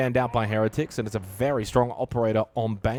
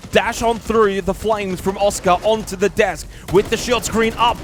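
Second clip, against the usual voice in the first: excited play-by-play; 9 semitones higher, 9 dB louder than usual. Real, not acted. Live play-by-play of the round.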